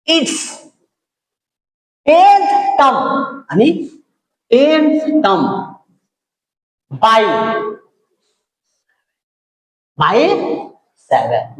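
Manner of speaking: word by word for note-takers; slow dictation. Real, not acted. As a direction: leave long gaps between words. Spelling out the question while writing.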